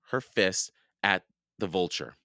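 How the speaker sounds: usual speaking voice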